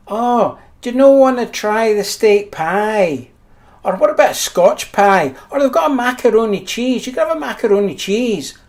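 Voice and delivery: mock mum voice tempting her kid; coaxing mum. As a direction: mimic a mother pushing other dishes. Mother coaxing child voiced.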